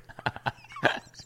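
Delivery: airy high laugh. Real, not acted. Joking about selling beer.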